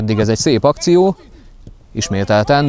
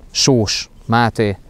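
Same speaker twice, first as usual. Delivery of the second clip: ordinary talk, nothing marked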